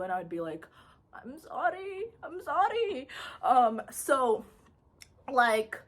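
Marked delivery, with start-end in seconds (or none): 1.1-3.1 mock apology. Retold child apologising.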